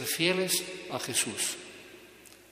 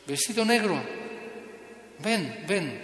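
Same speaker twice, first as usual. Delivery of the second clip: calling out; 4 semitones higher than usual. Retold or imitated. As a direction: call him back urgently. Dying man calls the missionary back.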